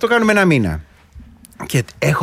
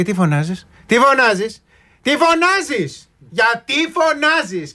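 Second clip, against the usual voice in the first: each repeat higher, last one drawn out; imitated mother's escalating complaint; mock scolding mother; 6 semitones higher, slower than usual. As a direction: nag in a climbing, high-pitched mum voice. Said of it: "γιατί το κάνανε οι μάνες".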